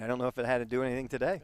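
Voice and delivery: normal talking voice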